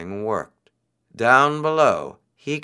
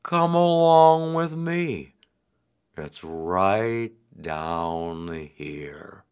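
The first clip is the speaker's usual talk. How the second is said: very slow, drawn-out turtle voice; 6 semitones lower, much slower than usual. A narrator's reading. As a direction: lead him along unhurriedly. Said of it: "he said".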